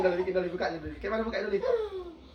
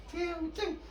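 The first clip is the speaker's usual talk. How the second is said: high squeaky cium; 10 semitones higher, 5 dB quieter than usual. Acted. Lagu anak, teriak cium.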